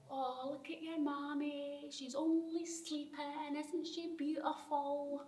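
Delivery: gentle imitation of grieving grown-ups; retold cooing. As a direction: softly coo over someone sleeping. Child retells adults over dead mother.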